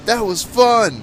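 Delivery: high, loud mock cheer; thrilled kids. Kids delighted once the ride ended, thrilled. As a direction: imitate excited kids squealing after a ride.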